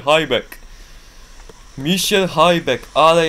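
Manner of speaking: hyped commentator; excited anticipation. Real, not acted. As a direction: sense something big coming. Anticipating a big jump.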